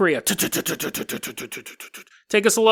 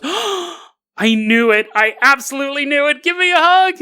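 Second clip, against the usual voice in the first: much higher, louder, building to the hug; a guest over the moon about the gift, higher and louder; thrilled guest; 7 semitones higher, 4 dB louder than usual. Acted. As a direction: squeal it like the gift is exactly right.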